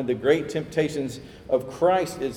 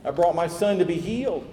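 mild mock yell, pitched higher; raised, pleading call; 6 semitones higher, 4 dB louder than usual. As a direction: call it out from a crowd, half-strength. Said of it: "yells out at him".

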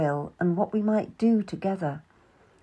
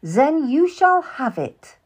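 slow, stately, higher voice, lady grants a favour; pronounced; 7 semitones higher, 4 dB louder, slower than usual. A narrator's reading. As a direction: declare it like a queen. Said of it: "Lady Anne pronounced".

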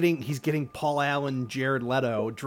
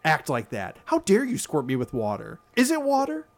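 calm Patrick Bateman style; 5 semitones higher, 6 dB louder than usual. Retold or imitated. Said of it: "super calmly and super kinda like Patrick".

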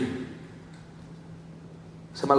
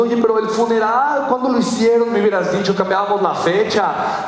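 mock concern; 8 semitones higher, 5 dB louder than usual. [name] asks about the funeral.

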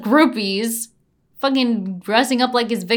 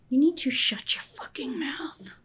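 whispering; 3 semitones higher, 7 dB quieter than usual. Real, not acted. Whispering to the dog.

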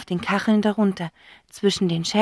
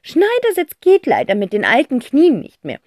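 put-on old aunt voice; 6 semitones higher, 6 dB louder than usual.

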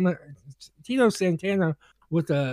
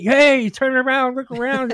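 calling out; 7 semitones higher, 5 dB louder than usual. Retold or imitated.

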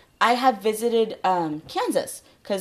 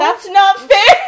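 laughing protest; 8 dB louder, slower than usual. Real, not acted.

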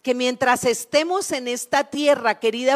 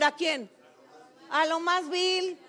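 ordinary delivery

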